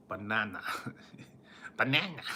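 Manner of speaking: Minion voice. Retold or imitated.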